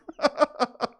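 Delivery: sudden high cackle; high laugh. Real, not acted. Laughs at own retort.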